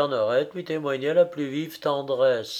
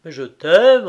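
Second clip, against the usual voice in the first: loud, higher, swelling on t'aime; ardent declaration; 4 semitones higher, 10 dB louder than usual. A narrator's reading. Frédéric answers his wounded mistress, ardent and loud. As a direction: burst out with heartfelt love. Lover reassures her, emphatic.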